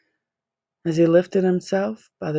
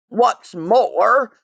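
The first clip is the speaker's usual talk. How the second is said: a mild, louder snap of the Lorax; 5 semitones higher, 5 dB louder than usual. Acted. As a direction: cut in sharply as the Lorax. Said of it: "snapped the Lorax".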